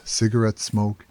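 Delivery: ordinary delivery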